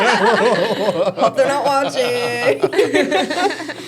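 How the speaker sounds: very slow, laughing it out. Real, not acted. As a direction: giggle the worry away. Joking about old coworkers.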